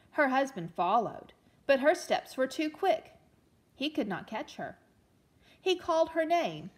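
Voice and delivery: put-on storytelling read-aloud for children; gentle read-aloud storyteller; story narration. A narrator's reading. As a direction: read it like a picture book to a class. Teacher reads story aloud.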